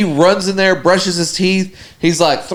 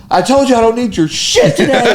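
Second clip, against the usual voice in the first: higher-pitched retold angry outburst; mock fury, pitched up; ready to kill; 7 semitones higher than usual. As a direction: imitate a fed-up roommate telling someone off. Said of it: "like, ready to kill me".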